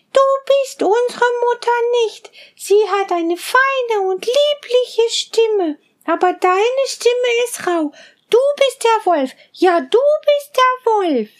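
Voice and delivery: put-on high, bleating kid-goat voices; little goat kids. Little goats promising their mother to be careful, high and bleating. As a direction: chirp it high like tiny goats.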